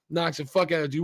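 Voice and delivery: usual speaking voice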